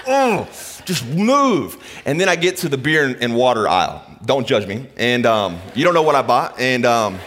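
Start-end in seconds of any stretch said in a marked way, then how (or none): 0.1-1.8 higher, very slow mock exasperation; frustrated. Stuck behind slow shoppers, drawn-out exasperated plea. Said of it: "I'm like".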